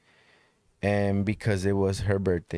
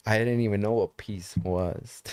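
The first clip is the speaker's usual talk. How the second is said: normal talking voice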